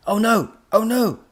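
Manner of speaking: high, very loud cries of alarm; panicked cry. A narrator's reading. Professor about to be flattened, panicked cry. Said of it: "von Stink cried".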